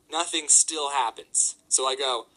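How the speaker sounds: ordinary delivery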